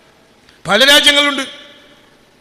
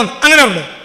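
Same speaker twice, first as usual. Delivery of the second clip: usual speaking voice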